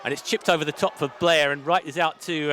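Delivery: usual speaking voice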